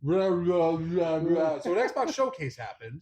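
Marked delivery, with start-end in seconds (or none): 0.0-1.6 loud, high gibberish blabber; comic high rewind babble; imitated rewind noise. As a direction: babble like a tape being run back. Mimics rewinding after "run it back.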